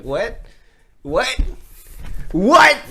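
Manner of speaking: rising to a loud high what; confused. Retold or imitated. Not understanding the dentist, building to loud high. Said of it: "So I was like".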